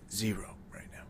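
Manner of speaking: whispered, sheepish aside; whispered embarrassed admission. Real, not acted. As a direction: breathe it out like a confession. Admits no Patreon subscribers.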